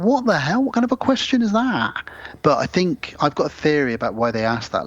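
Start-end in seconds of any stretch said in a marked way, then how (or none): 0.0-1.9 high, disbelieving outrage; incredulous. Retold or imitated. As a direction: ask it like you can't believe it. Outraged reaction retold.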